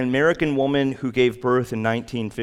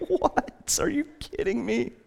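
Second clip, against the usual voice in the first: incredulous disbelief; 13 semitones higher than usual. Retold or imitated. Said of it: "your first reaction is just like".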